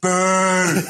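long, high mock boo; crowd booing. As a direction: boo the screen like an annoyed audience. Imitates audience booing.

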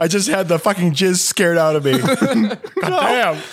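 high, laughing through the words; cracking up as you say it. Real, not acted. Group cracking up.

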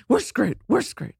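frantic repeat, lightly played; Chicken Little panic. Husband still panicking by the car, mock wail. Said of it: "full-on Chicken Little syndrome".

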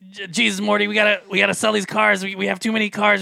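high, fast Rick impression. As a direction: rant like a frantic Rick. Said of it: "Do it like Rick".

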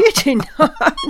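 laughing protest. Real, not acted. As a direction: laugh through the words. Host laughing in disbelief.

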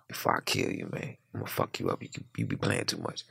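whispered low menacing growl; low hushed threat. Retold or imitated. Old lifer warning him, hushed and low.

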